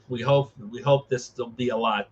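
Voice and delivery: usual speaking voice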